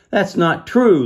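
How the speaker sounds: high, loud protest; shouted denial. A narrator's reading. Lucas shouting to defend Petros from the shadow. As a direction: shout it back at the insult. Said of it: "Lucas shouted".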